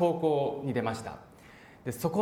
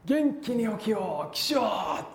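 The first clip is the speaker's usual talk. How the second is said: a lightly imitated cheery wake-up call, slowed down a little; hint of a booming dorm teacher; teacher's wake-up call; 5 semitones higher, much slower than usual. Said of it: "山中に響く声で".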